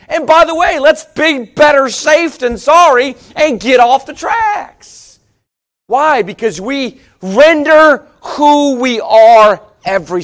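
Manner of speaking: high, loud, shouted preaching at the climax; full-force preaching. Acted. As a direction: drive the train image home to the congregation. Preaching climax, train illustration.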